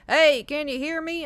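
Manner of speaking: loud, high, drawn-out holler; calling out. Retold or imitated. Mom's boyfriend shouting over the car speaker, calling out. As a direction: holler it down a bad phone line.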